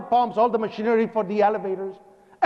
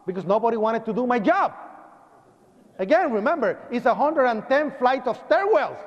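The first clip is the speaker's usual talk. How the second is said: ordinary talk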